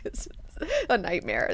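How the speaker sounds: hushed, breathy aside of disgust; disgusted whisper. Retold or imitated. Duncan whispering his disgust at the village. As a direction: whisper it like you can't believe this place.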